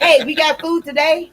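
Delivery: put-on high, loud toddler voice; cheerful little kid shouting; baby voice. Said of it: "voicing the baby".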